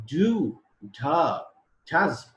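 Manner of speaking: stressed full vowels; full strong-form demo. Shown as a demonstration. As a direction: say each strong form clearly and loud. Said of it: "these are the strong and the weak forms".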